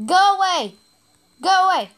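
frantic real yell at an enemy; yelling at enemies. Real, not acted. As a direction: shout them off your character.

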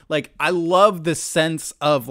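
ordinary talk

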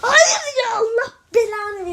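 a cry-shout at the game; anguished shout. Real, not acted. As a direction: yell the curse half crying. Dies again, cursing the game.

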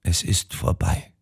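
soft whispered resignation; geflüstert. A narrator's reading. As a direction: whisper it, defeated. Resigned confession begins.

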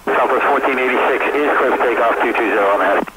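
tinny cockpit radio transmission; pilot over the radio. Real, not acted. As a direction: read back like a pilot on the radio. Pilot reading back on radio.

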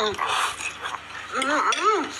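ordinary talk

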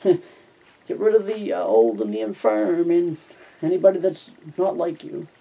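mock pronouncement. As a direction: stretch it out in mock solemnity. Reader's mocking aside on the story.

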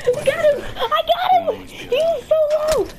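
high, loud victory shout; real gamer celebration; triumphant yell. Real, not acted. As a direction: cheer the kill at the top of your voice. Celebrating a kill.

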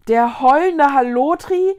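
loud disbelieving question; hoarse repeat. A narrator's reading. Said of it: "wiederholte sie heiser die Worte".

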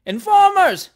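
very high, loud jeer; crowd shout. A narrator's reading. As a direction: yell it as an accusing mob. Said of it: "shouted the crowd".

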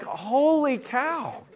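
long drawn-out, high "holy cow"; astonished. Retold or imitated. Pastor retelling a parking-lot spat, astonished. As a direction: stretch it out in amazed disbelief. Storyteller acts out his astonishment.

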